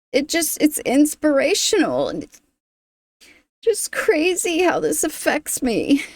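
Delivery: trying to talk while fighting off tears; choked up, crying. Real, not acted. Moved to tears reacting to a song on camera, choked and high. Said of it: "I hate crying on camera".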